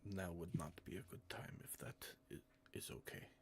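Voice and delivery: hushed, breathy whisper. Acted. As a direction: drop to a whisper. NPC lowers voice, asks to wait.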